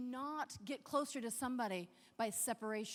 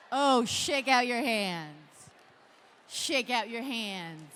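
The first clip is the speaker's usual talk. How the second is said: slow wind-down; 8 dB louder, slower than usual. Real, not acted. Calming after the claps.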